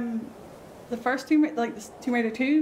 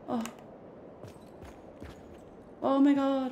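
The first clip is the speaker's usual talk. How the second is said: ordinary delivery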